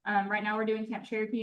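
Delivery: ordinary delivery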